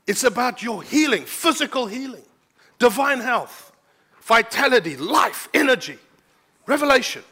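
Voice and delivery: high, loud preaching push; fiery preaching. Acted. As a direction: preach it out with full fire. Preaching at full force.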